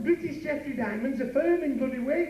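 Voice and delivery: high-pitched comic scolding; indignant, high telling-off; exasperated correction. Acted. Corrects partner's silly blunder.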